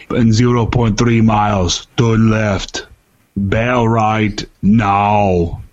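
put-on slow Austrian-accented navigation voice; Arnold Schwarzenegger. Imitating the boss's traffic app giving directions, slow Austrian accent. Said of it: "he's using Schwarzenegger".

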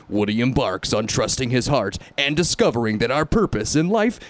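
dramatic trailer announcer, slow and booming; movie trailer voice. Acted. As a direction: read it like an epic movie trailer. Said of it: "I don't think that was your D J voice. I think that was, like, ... that guy".

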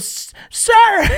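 high, strained cry of sir to the stranger; panicked warning. Retold or imitated. Buddy calls out to the man on the bench in panic. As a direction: yelp a warning to a man about to be hit. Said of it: "And he goes".

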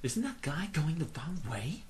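hushed whispered aside; whispering. Real, not acted.

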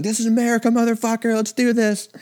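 high, far-off hyped-up ring announcer voice; wrestler entrance hype. Retold or imitated. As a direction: belt it out like a pro wrestler making his entrance.

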